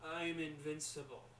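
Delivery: slow, understated dying words; shuddered last words. Acted. Actor playing dying dad in movie, slow last words. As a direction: say it slowly, with the boast gone out of it. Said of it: "as he shuddered his last words".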